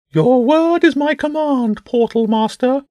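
high, slow pigeon voice; obey grandly in a high bird voice; bishop bird. Acted. Bishop bird takes James's order, high bird voice.

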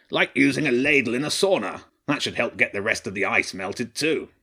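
lightly voiced wry badger king; a badger king making a homely joke. A narrator's reading.

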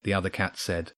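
ordinary delivery, nothing marked

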